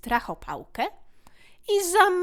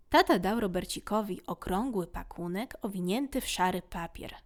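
ordinary delivery

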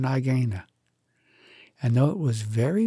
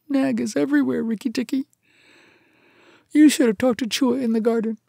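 high-pitched anxious little voice; timid muskrat, fearful warning; 12 semitones higher, 4 dB louder than usual. Acted. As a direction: warn nervously in a high creature voice.

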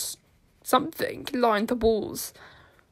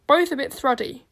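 usual speaking voice

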